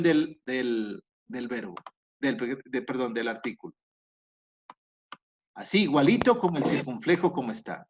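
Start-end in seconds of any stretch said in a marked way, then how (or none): none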